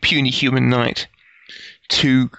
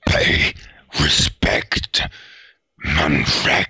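creature voice; 10 semitones lower, much slower than usual. Acted. Creature demands respect from half elf.

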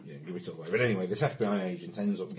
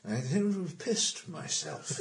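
slow, lightly voiced old professor; a hint of a befuddled old man; retold old man; 7 semitones higher, much slower than usual. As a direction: drawl it out like a dotty old man. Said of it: "the old boy goes".

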